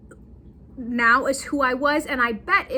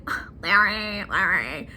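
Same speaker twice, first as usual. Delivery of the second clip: reluctant wife groan; 5 semitones lower than usual. Retold or imitated.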